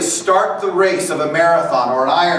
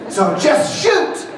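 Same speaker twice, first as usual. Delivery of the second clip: slow, high, laughing mock instruction; mock coach; 9 semitones higher, much slower than usual. Coach telling players aimlessly, slow mocking laugh. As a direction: drag it out as a silly order. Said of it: "Do they sit there and go".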